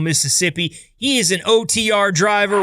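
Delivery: ordinary talk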